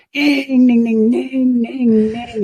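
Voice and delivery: high, sung-out ding for each misfiring cylinder; sputtering engine noise. Retold or imitated. Pilot imitating his limping engine, high sputtering dings.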